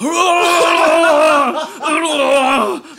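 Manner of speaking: long, high, loud battle yell; full-throated mock war cry. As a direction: roar as you charge under the door. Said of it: "with my battle cry, I went".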